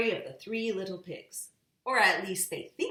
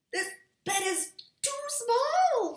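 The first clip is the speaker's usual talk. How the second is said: much higher little character voice; high squeaky voice; 5 dB louder than usual. A narrator's reading. Reader voicing the little one's complaint, high-pitched. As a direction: pipe it up small and high. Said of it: "said somebody".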